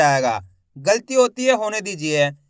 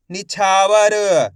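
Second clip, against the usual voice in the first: slow and louder, each sound stretched; slow blended demo; 5 dB louder, much slower than usual. Shown as a demonstration. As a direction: spell it out slowly for the class. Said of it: "शिक्षक शब्द जोड़कर पढ़ाता है". (ज़ोर से और धीरे-धीरे)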